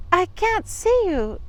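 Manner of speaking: slow, wary and questioning; suspicious. Acted. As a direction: sound doubtful and careful. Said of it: "said Little Red suspiciously".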